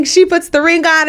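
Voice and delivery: normal talking voice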